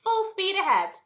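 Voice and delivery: high, loud rallying cry; shouted command. A narrator's reading. Wonka urges the rowers to full speed, shouting. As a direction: drive the crew on. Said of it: "shouted Mister Wonka, jumping up".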